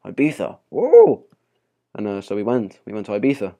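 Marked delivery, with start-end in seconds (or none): 0.0-1.2 excited cheer. Retold or imitated. Retold holiday excitement.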